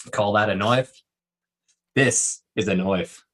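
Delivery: lightly done mock Australian accent, slow on the second line; a friend hamming up a movie Australian. Hamming an Australian accent for friends on the show, lightly voiced mock Australian. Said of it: "very good Australian accent".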